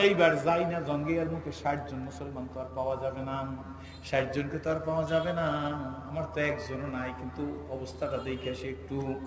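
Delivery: low, soft chanted narration; sermon storytelling. A narrator's reading. Preacher voicing Abu Ubaidah fearing for sixty men, soft storytelling. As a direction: drop down into quiet, measured telling.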